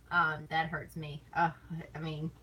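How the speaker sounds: ordinary delivery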